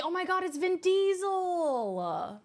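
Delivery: high, slow mock-amazed gush; high teasing squeal of delight. Real, not acted. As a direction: gasp it out like spotting a star. Teasing a viewer's new look.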